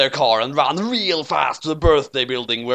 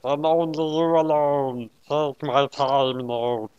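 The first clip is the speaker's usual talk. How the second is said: slow solemn otherworldly intro; slow Shinigami voice; 2 semitones higher, slower than usual. A narrator's reading. Shinigami introduces herself to L, slow solemn voice. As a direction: announce yourself slowly, ominous.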